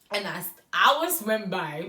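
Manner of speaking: usual speaking voice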